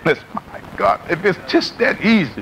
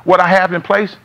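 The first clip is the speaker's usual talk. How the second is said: usual speaking voice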